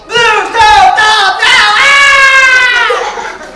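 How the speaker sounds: loud, high, panicky yelling at the dog; frantic yelling. Shown as a demonstration. Trainer showing how not to yell at a wolf, loud frantic yelling. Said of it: "I don't go".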